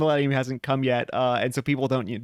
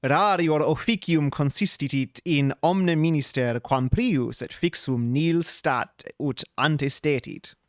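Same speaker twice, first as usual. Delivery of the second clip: reading Latin verse slowly, bad accent. Shown as a demonstration. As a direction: recite the Latin with a clumsy accent. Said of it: "my Latin accent is terrible".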